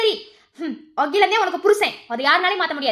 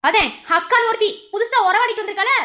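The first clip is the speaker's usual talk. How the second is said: ordinary delivery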